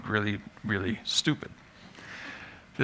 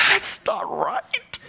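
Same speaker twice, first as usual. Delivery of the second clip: mock self-scolding; 4 semitones higher, much slower than usual.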